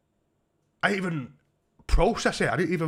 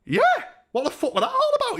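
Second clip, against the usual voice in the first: very high, quick mock outburst; acted disbelief; 4 dB louder, faster than usual. Punched man asking his mate, high mock-incredulous. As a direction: race through it in high-pitched bafflement.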